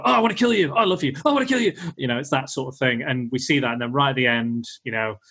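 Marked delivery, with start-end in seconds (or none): 0.0-1.7 high, loud hammed-up flips from love to murder; an unstable sister overplayed for laughs; mock mood swings. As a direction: swing wildly between kill and love. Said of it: "her emotions will just spin on a dime".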